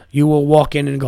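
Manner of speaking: ordinary talk, nothing marked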